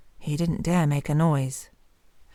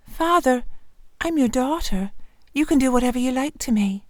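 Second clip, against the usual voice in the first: higher, meek daughter giving in; gentle daughter; 7 semitones higher, faster than usual. Acted. Daughter submits to father.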